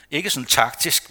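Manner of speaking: ordinary talk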